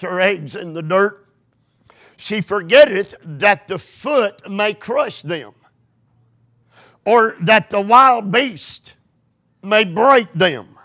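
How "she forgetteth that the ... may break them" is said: scripture reading. Acted. Reads scripture, building up.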